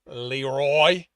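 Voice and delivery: low, loud growl of a name. A narrator's reading. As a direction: growl it as a stern warning. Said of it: "he growled".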